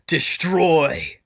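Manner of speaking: loud villain cry; villain shout. Acted.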